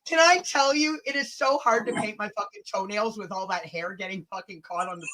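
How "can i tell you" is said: ordinary talk, nothing marked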